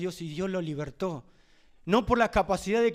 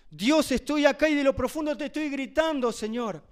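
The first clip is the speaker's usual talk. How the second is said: higher, louder plea to God; psalmist crying out; 6 semitones higher, 4 dB louder than usual. Retold or imitated. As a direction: cry out from the depths. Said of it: "y dice".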